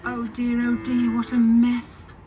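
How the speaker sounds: ordinary delivery, nothing marked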